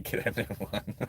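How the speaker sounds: words broken up by chuckles; laughing. Real, not acted. Reacting to viewer's advice to throw the hat away, laughing. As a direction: chuckle through the words, softening.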